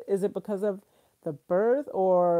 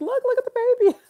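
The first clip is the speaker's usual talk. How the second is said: high, louder, eager call to look; excited showing off; 9 dB louder, much faster than usual. Retold or imitated. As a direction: thrust the phone at him in delight. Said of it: "I was showing Eric".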